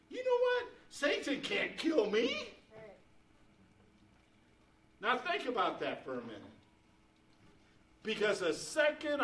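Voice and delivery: loud, emphatic sermon climax; high, loud proclamation to the congregation; full-force preaching. Real, not acted. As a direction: preach it out with rising conviction.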